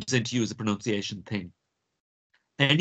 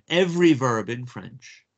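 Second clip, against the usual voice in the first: "every verb in French" slowed and stressed for note-taking; slow dictation; slower than usual. Shown as a demonstration. Teacher dictating "every verb in French" to note-taking students. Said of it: "if you just want to take this down".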